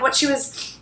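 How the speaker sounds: usual speaking voice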